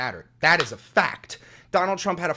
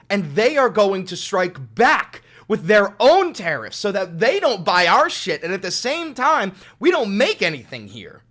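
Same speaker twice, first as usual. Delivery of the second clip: loud, higher-pitched exasperated rant; real indignation, voice raised; heated rant; 5 semitones higher, 7 dB louder, slower than usual. Real, not acted. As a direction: hammer the points out loudly. Heated rant against the tariffs.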